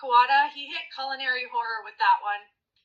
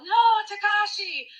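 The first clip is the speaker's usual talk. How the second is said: dramatic tears; 8 semitones higher, 4 dB louder, slower than usual. Retold or imitated. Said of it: "with dramatic tears".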